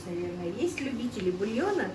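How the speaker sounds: ordinary delivery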